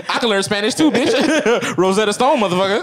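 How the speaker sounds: high, laughing mock brag; laughing taunt. Real, not acted. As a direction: tease through laughter. Mocking joke while laughing.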